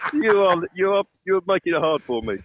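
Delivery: talking through laughter; laughing. Real, not acted. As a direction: laugh out the words. Laughing while hauling luggage.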